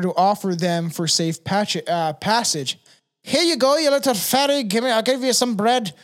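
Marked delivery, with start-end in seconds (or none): 3.2-5.9 high-pitched put-on traveler voice; wary fairy offering. Traveler offers a little fairy bread, high put-on voice. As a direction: coax the fairy in a raised, playful voice.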